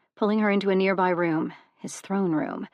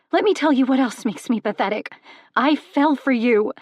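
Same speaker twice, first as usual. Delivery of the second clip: higher, louder anguished confession; hurt outburst; 6 semitones higher, 8 dB louder than usual. Acted. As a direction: shout the hurt out. Hurt outburst before tears.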